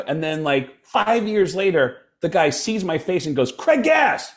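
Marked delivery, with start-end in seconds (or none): 3.5-4.3 high, drawn-out call of recognition; delighted greeting. Retold or imitated. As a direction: hail an old pal by name. Retold delighted greeting across room.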